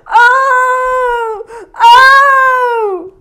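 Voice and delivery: high loud mock wailing; hammed-up fearful moan. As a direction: wail like a coward in despair. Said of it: "they didn't say".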